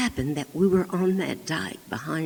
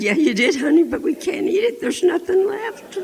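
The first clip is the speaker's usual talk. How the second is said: much higher and louder, half-laughing; laughing dad; 13 semitones higher, 8 dB louder than usual. Retold or imitated. Dad amused at the blasted rabbit, laughing. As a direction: tell her through laughter.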